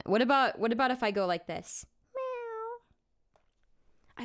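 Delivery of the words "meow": squeaky drawn-out kitty meow; high cat meow. Shown as a demonstration. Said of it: "I love to meow at cats".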